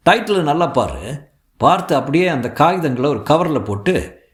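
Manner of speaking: pressing phone voice of a father; urgent father. Acted. Father gives instructions on phone.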